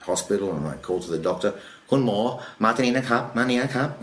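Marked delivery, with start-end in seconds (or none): 1.9-4.0 calling out. Retold or imitated. Calling to Thai doctor.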